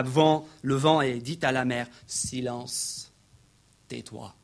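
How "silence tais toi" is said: low, soft order to be still; quiet authority, falling away softer; hushed command. Acted. Jesus stilling the sea, in a hushed command.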